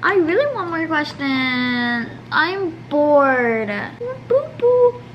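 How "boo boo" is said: boo the empty question list. Real, not acted. Bored, booing for more questions.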